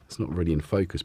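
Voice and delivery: normal talking voice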